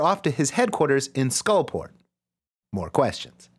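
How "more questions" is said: mock questioning. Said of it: "bottle sentence".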